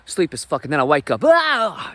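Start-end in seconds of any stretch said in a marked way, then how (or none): 1.2-1.9 sudden high loud startled yelp; exaggerated waking yelp. Comic telling the audience he woke up with a startled yelp. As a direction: jolt awake with a hammed-up cry. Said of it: "that might have been a little over-exaggerated".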